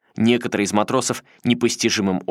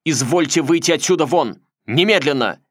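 higher, louder furious command; sharp angry order; 6 semitones higher, 4 dB louder than usual. A narrator's reading. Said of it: "резким, очень злым голосом Фролов приказал". (резкий злой приказ)

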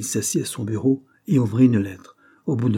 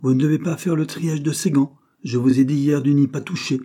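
higher, louder scolding of a co-worker; scolding call; 6 semitones higher, 5 dB louder than usual. A narrator's reading. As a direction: rebuke him for touching the gloves. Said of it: "lui cria-t-il".

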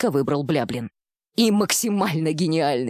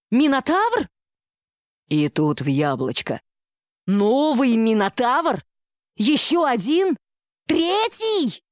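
high, stunned shouts climbing ever higher; shouting; 5 semitones higher, slower than usual. Acted. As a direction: yell each question in mounting disbelief. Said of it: "Поймав себя на оре".

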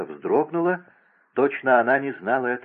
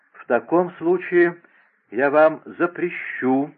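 высоко, медленно, с остановками на ходу; тяни слова, будто сбилось дыхание от ходьбы; прерывающийся голос; 6 semitones higher, 4 dB louder, slower than usual. A narrator's reading. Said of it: "сказала принцесса прерывающимся голосом". (прерывающимся от быстрой ходьбы голосом)

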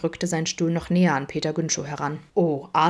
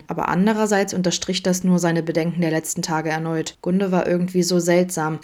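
usual speaking voice

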